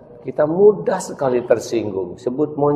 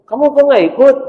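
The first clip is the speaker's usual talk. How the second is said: much higher, louder lady calling from a car; high mother voice; 9 dB louder, much faster than usual. Acted. Friend's mother calls to the boy from her car, high. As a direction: call out from the car, high and loud.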